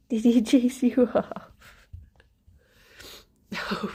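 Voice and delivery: usual speaking voice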